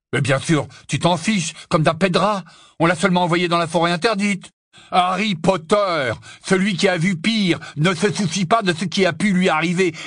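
loud, high mocking tirade; bitter scorn. Ron mocks Harry for not caring about his sister, scornful. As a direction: sneer the accusation loudly.

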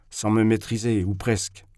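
ordinary delivery, nothing marked

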